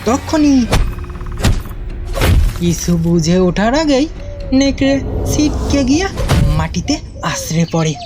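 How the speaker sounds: ordinary delivery, nothing marked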